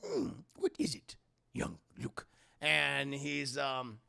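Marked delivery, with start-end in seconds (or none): 0.0-2.2 Yoda voice. Retold or imitated. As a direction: croak it like the wise little master. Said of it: "And Yoda's like".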